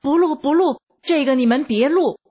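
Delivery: narrated angry outburst, quickening at the end. A narrator's reading. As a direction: order them to stop, rattled and cross. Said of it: "气得瞪着眼珠子说".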